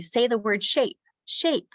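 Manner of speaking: high-pitched classroom cue; teacher's lifted drill voice; teacher to class. Shown as a demonstration. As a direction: cue young pupils to repeat a word. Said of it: "and I will say".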